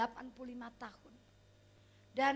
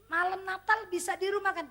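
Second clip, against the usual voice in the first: eager question; 4 semitones higher, 9 dB louder than usual. Retold or imitated. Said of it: "saya tanya".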